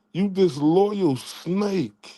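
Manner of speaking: drawn-out, deliberate taunt; slow insult. Real, not acted. Streamer slowly taunting a rival he calls disloyal. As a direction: spell out every word of the insult slowly.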